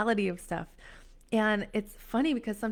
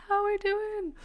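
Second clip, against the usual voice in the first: very high little voice; high-pitched playful check-in; playful high echo; 12 semitones higher than usual. Real, not acted. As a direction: repeat it high, without shouting. Echoes the call more softly.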